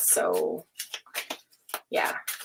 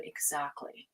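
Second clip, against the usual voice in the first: whispered, much softer; whispered reply, imitating son; hushed exactly; 6 semitones lower, 15 dB quieter, slower than usual. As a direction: breathe the word out like a secret. Son's quiet emphatic answer.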